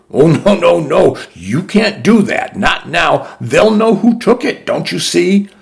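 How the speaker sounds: high, urgent alarm. A narrator's reading. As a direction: stop her in a panic. Friar alarmed, pleading.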